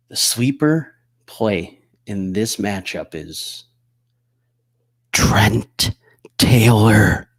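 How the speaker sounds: secretive whisper, right in your ear. Real, not acted. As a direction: close whisper into the mic. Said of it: "I'm gonna whisper this into the mic".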